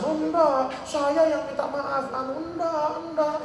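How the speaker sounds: high-pitched, protesting refusal; mock insistent arguing. [name] and the woman argue over thanks, high insistent protest. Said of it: "setelah otot-ototan".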